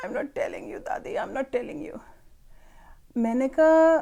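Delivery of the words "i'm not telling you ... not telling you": put-on crying child. باقاعدہ وہ رو رہی تھی.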